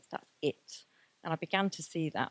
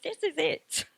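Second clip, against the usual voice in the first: much higher and louder, laughing through it; excited declaration; 9 semitones higher, 6 dB louder than usual. Retold or imitated. Telling Mara on a supervision, gleeful and high. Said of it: "actually said to Mara".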